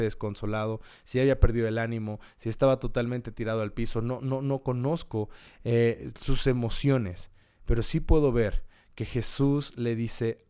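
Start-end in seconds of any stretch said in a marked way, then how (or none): none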